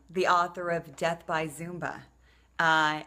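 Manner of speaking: ordinary talk, nothing marked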